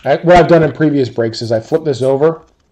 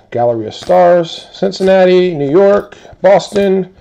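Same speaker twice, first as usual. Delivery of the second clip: higher and louder, rattling off teams; excited call-out; 6 semitones higher, 4 dB louder than usual. Real, not acted. Box breaker calling out teams to viewers, excited. Rapid-fire card team call-out.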